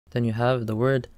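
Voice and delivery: usual speaking voice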